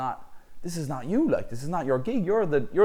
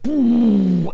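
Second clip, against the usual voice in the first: 1 loud mock boom; 11 semitones higher, 11 dB louder than usual. Mimicking the punch impact.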